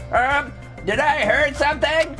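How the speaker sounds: put-on high little voice with southern accent; small southern fellow voice. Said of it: "a little person's voice, but with a southern accent".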